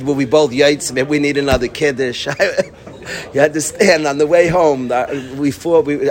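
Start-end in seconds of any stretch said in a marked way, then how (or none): none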